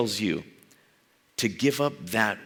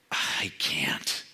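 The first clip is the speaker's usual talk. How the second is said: whispered refusal; 9 semitones lower than usual. Pretend. Preacher whispering the listener's refusal to Jesus. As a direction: breathe it out ashamed.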